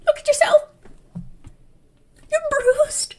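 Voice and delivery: high, strangled mock wail; overacted high-pitched despair; hammed-up dramatic. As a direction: squeak it out as if overcome with anguish. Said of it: "he's all dramatic".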